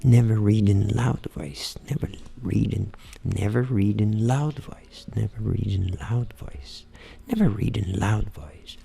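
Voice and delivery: slow, low-pitched repetitions, partly whispered; murmured English practice, over and over. Shown as a demonstration. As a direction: drill the phrase low and drawn out. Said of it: "treinar em voz baixa".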